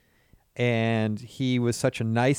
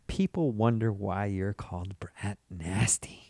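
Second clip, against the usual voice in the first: whisper; 5 semitones lower, 10 dB quieter than usual. Real, not acted. Teasing aside.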